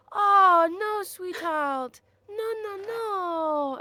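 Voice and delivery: put-on high, drawn-out, fussing over a crying child; distressed comforting. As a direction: coo in dismay to a tearful kid. Consoles a kid who starts crying.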